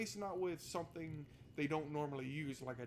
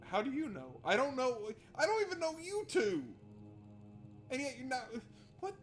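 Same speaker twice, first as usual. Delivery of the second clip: incredulous complaint climbing in pitch; rising exasperation; 9 semitones higher than usual. Real, not acted. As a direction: build from puzzled to fed-up. Frustrated rant at NPCs.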